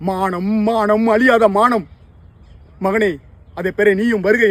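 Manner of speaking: loud, high proclaiming of honour; proud cry. Acted. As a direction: summon him with pride. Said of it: "mother's proud call to son". (பெருமிதத்துடன் உரத்த முழக்கம்)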